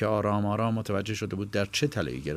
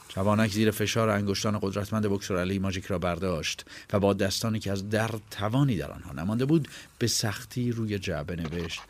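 ordinary delivery

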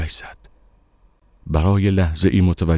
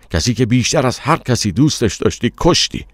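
high-pitched accusation, building to the end; accusing; 7 semitones higher, 4 dB louder than usual. A narrator's reading. Stranger charging the narrator with killing his beloved, accusingly. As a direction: build to the last word, higher and louder.

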